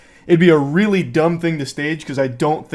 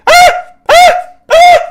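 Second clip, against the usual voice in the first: loud short panicked cries; panicked yelps; 14 dB louder than usual. Shown as a demonstration. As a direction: yelp uselessly in a panic. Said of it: "I'll just sit there going".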